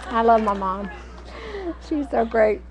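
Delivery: ordinary talk, nothing marked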